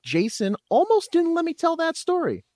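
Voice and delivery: mock accusation. Mock complaint.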